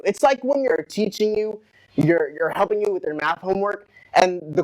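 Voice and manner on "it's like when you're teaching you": ordinary talk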